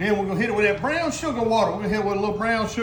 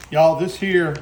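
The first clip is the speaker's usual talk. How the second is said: ordinary talk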